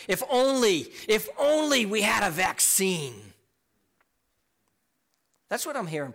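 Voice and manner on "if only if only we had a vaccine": loud, high, pleading wail; imitated crying out. Preacher imitating people longing for a vaccine, loud crying out. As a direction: cry it out as a desperate wish. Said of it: "And people cry out".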